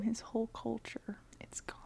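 fading into a near-silent whisper; choked up, barely whispering; tearful whisper. Real, not acted. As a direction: let the voice vanish with grief. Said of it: "I'm gonna cry".

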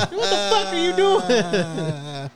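very high, very slow stretched-out mock complaint; imitating the kid. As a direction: drag it out high like the kid in the clip. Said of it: "when the barber rubbed on his lip, he said".

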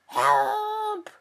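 a high, loud scream for help from the hen; terrified scream. A narrator's reading. Hen fleeing her coop screams in panic. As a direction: shriek it out in panic, far above the voice. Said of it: "she screamed".

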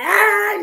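sudden high loud wail, imitating a past sob; mock crying wail. Recalling crying on the beach with her mentor, loud mock wail. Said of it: "I was crying".

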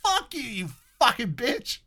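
imitating an angry reply; mock retort. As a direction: drag out the comeback. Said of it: "And I go".

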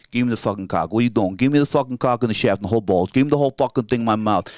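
loud, quick New York accent; New York accent at a brisk clip. Shown as a demonstration. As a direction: rattle it off like a pushy New Yorker. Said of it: "Like, in New York".

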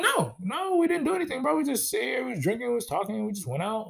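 high, defensive string of excuses; retold innocent denial. Lying denial to the husband.

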